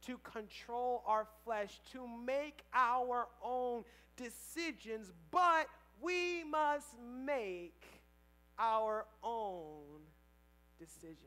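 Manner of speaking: slow, loud emphatic preaching; drawn-out sermon emphasis. Acted. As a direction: lean hard into every word. Preaching climax, slowed.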